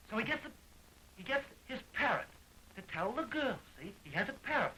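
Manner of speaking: normal talking voice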